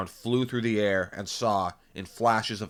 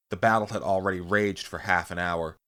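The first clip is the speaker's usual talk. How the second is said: ordinary delivery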